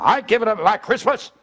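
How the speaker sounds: high, loud defiant shout; retold angry yell. Brother-in-law refusing to give up Christmas, shouting. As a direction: bellow it like a furious brother-in-law. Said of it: "And he's screaming at me".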